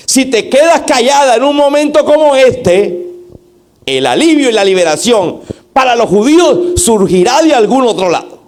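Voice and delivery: high, insistent rebuke; reproachful tone. Acted. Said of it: "eso fue en el siguiente tono".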